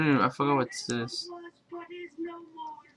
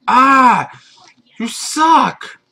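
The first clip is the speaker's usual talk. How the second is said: sudden high yell, much louder; angry yell; 10 semitones higher, 11 dB louder, much slower than usual. Real, not acted. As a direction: yell at the attacker. Attacked by mob, yelling.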